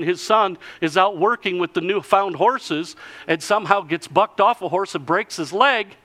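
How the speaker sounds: ordinary talk, nothing marked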